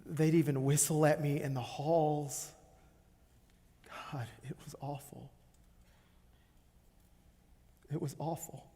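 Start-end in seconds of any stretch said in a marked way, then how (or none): 4.1-8.7 very soft, subdued anguish; almost to yourself, reliving it; hushed, pained murmur; pained recollection. Acted. Recalling being mocked at school.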